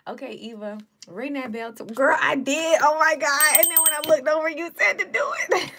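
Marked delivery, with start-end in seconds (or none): 1.7-5.7 loud, high laughing shout; excited shriek. Real, not acted. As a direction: shriek it out in shock. Shocked excited reaction to bell.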